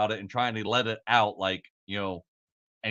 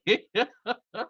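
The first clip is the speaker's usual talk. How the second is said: long, high-pitched laughing fit; helpless laughter; 11 semitones higher than usual. Real, not acted. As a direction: laugh uncontrollably, for real. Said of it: "just sitting here fucking laughing".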